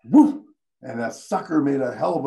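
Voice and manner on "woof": loud, high whooshing blast; extinguisher blast. Retold or imitated. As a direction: burst it out like a fire extinguisher.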